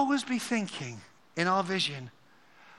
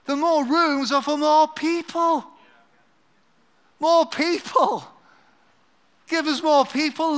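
passionate preaching; 5 semitones higher, 4 dB louder than usual. Real, not acted. Passionate climax and prayer.

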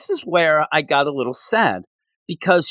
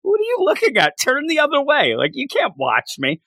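put-on high-pitched indignant girl, easing down; 12 semitones higher than usual. Girl tells the boy not to watch, indignant. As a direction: act out a girl telling someone off.

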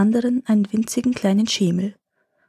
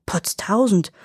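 startled, pitched-up echo; surprised question; slower than usual. A narrator's reading. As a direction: caught off guard by the answer. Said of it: "fragte Tom erstaunt".

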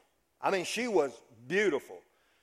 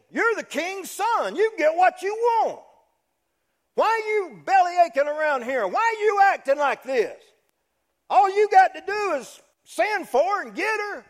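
much higher, louder egging-on voice; a pushy friend needling the prince; goading buddy; 12 semitones higher, 5 dB louder than usual. Retold or imitated. Amnon's buddy urging him to take Tamar, loud high goading. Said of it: "looked at him and said".

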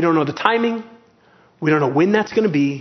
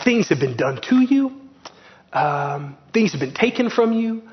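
ordinary talk, nothing marked

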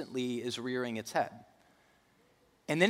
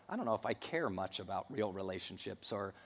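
indifferent listener; 5 semitones lower, 8 dB quieter than usual. Retold or imitated. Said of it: "out there going".

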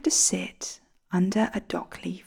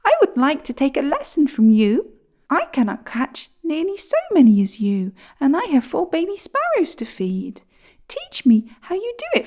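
higher, pleading little bird voice; sparrow voice; 7 semitones higher than usual. Acted. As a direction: ask like an anxious mother sparrow. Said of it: "asked the sparrow".

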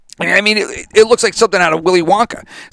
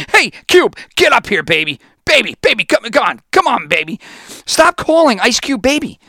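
mock Goodell; 7 semitones higher than usual. Mocking Goodell calling [name] baby.

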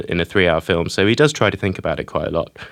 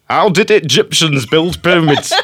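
Ridley impression; 6 semitones higher, slower than usual. Host quotes Ridley on the pyramids, in a laughing mock Yorkshire voice. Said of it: "And he was like".

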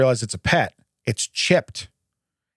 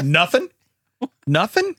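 parrot voice; 5 semitones higher, slower than usual. Pretend. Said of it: "in the parrot voice".

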